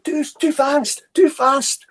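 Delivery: high-pitched imitation of the interpreter; mock high-voiced protest; interpreter's frantic plea. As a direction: cry it out high, urgent. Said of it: "she's going".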